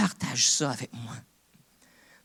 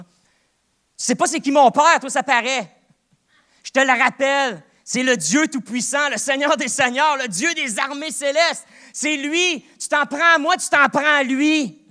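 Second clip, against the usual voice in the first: shouting down the devil; 14 semitones higher, 12 dB louder than usual. Shown as a demonstration. Preacher showing how he confronts the devil, full-force defiant shouting. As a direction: roar it at the devil like a bouncer naming his boss. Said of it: "je parle comme ça avec le diable des fois".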